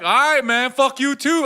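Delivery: high, loud comeback; yelled retort. Retold or imitated. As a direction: shout the insult right back. Retold shouted exchange of insults.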